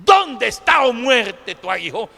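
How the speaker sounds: ringing apostolic challenge; defiant. Acted. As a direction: throw the question in death's face. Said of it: "desafiante del apóstol Pablo ante la muerte".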